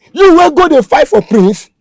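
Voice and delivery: a slow, loud taunt; angry accusation. Acted. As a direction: spit it at him slowly. Kidnapper accuses the captive.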